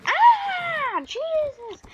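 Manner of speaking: high-pitched startled cry; mock frightened yelp; scared. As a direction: jump in fright, voice shooting up high. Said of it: "it scared me".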